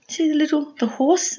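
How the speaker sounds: usual speaking voice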